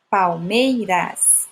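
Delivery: Palmeiras drawn out slowly, weight on MEI; slow Palmeiras with a strong middle syllable; stress on MEI. Shown as a demonstration. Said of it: "é a última sílaba forte".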